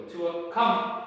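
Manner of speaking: loud recall command to a dog; loud come command. Shown as a demonstration. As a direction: call the dog to you firmly. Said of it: "I will just simply go".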